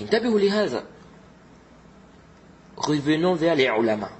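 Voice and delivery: normal talking voice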